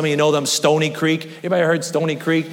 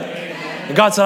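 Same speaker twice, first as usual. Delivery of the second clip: ordinary talk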